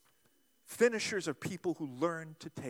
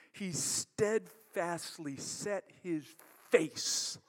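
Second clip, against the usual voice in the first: slow, deliberate; much slower than usual. Shown as a demonstration. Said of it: "like he steadfastly set his face".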